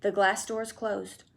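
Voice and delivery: ordinary delivery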